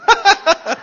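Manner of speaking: a sudden high laugh; high burst of laughter. Real, not acted. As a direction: laugh out at your own joke. Laughs at own joke.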